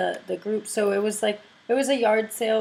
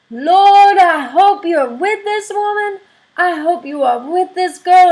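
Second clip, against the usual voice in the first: high, loud, impassioned preaching prayer; fervent prayer; 9 semitones higher, 9 dB louder than usual. Retold or imitated. Older churchwoman loudly praying over the speaker for healing. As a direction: call on Jesus to heal her.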